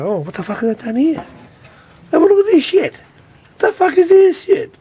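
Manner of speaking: finding filth under the board, high mock outrage; mock disgusted surprise. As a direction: play it up, aghast at the grime. Mock reaction to dirty board.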